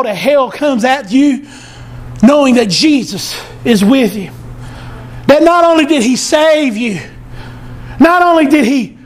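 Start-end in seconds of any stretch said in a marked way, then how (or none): none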